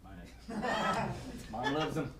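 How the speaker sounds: ordinary talk, nothing marked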